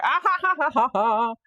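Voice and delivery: high, loud burst of delighted laughing; giddy triumphant laugh; real laughter. Real, not acted. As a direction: laugh out of pure relief. Thrilled the fix worked.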